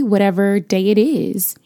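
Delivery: ordinary talk, nothing marked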